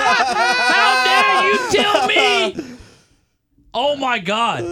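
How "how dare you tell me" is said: drawn-out, high, laughing imitation; laughing mock outrage. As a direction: stretch it out while cracking up.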